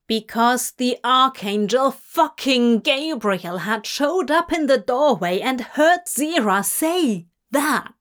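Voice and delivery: ordinary delivery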